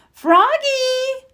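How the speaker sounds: high, drawn call of Froggy; teacher calling Froggy across the pumpkin patch, high drawn call. A narrator's reading. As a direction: call him back across the field. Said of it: "called his teacher".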